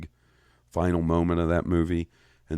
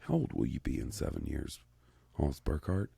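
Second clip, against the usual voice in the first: much softer, a low murmured taunt from his own brain; his brain taunts him about his age, softly; nagging inner voice; 4 semitones lower, 11 dB quieter than usual. Retold or imitated. Brain needling him about his age, in a hushed ominous murmur. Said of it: "my brain did the math and was like".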